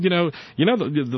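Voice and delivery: normal talking voice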